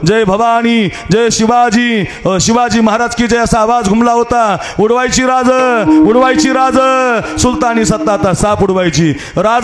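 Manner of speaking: high, ringing sermon voice rallying the crowd; fiery kirtan preaching. Acted. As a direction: preach it up to the crowd at full force. Kirtan preacher builds to fiery climax.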